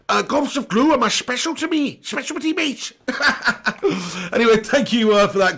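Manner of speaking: very high, slushy Scouse caricature; over-the-top Scouse, spitting out the esses; Jamie Carragher impression. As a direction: squeal it as a mock Scouse pundit. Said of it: "my name's Jamie Carragher".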